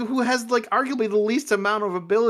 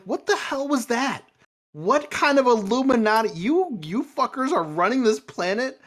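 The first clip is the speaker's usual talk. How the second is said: higher-pitched, exasperated outburst; incredulous rant; 7 semitones higher than usual. Real, not acted. Fan ranting about the Illuminati's failure, incredulous. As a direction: demand an answer in disbelief.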